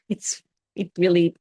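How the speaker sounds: ordinary talk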